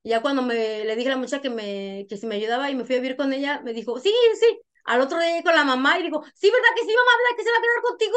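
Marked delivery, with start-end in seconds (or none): 4.0-4.6 imitated eager yes, high and loud; eager agreement. Imita a la muchacha aceptando ayudar. 6.4-8.1 imitating a daughter coaxing mamá, very high; eager coaxing. Imita a hija rogando a mamá.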